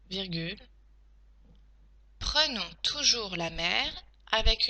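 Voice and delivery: measured teacher dictating in short chunks; careful, segmented delivery; dictation. Shown as a demonstration. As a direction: dictate for pupils writing it down, pausing between phrases. Dictates text with punctuation.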